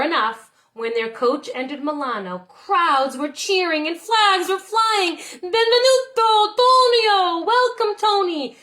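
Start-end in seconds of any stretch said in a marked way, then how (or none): 5.2-8.6 high, loud crowd cheer. A narrator's reading. Crowds cheering Tony into Milano. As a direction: hail him like a cheering crowd. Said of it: "The crowds cheered".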